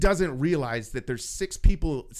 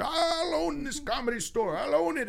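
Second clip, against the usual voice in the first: ranting ejected customer; 12 semitones higher than usual. Retold or imitated. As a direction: imitate a distant blustering troublemaker. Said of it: "this guy's out here".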